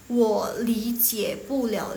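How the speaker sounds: drawn-out, syllable-by-syllable Mandarin; slow model reading. Shown as a demonstration. Teacher introduces phrase number two, slow model reading. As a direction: sound out each word slowly. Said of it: "yang nomor dua".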